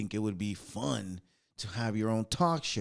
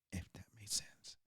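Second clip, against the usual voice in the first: whisper; 5 semitones lower, 20 dB quieter than usual. Real, not acted. Trailing aside.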